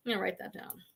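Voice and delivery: soft whispered aside. Real, not acted. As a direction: whisper it to yourself. Whispered aside after viewer tip.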